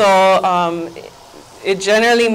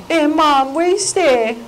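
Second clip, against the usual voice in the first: put-on higher-pitched child calling out a question; kid calling Mom; 7 semitones higher than usual. Kids asking their mother where she is, high child voice. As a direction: put on a kid's voice, looking for a parent. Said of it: "when your kids ask you".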